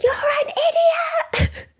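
high shrieking yell at the other driver; retold shout. Yelling at the driver who nearly hit her car, high furious shriek. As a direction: shriek the insult in high road rage. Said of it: "I was just shouting".

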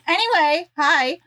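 bright loud hello; loud greeting. Real, not acted. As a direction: greet loudly like an announcer. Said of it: "Screaming at me".

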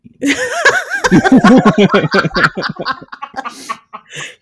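high, loud burst of laughter; long loud laugh. Real, not acted. Host cracks up at co-host's Costco joke, loud laughing.

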